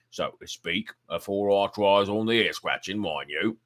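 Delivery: put-on old keeper talking shop over his pipe, loud and blunt; gruff old zookeeper. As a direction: boom it out like a blunt working man. Keeper speaks in dialect.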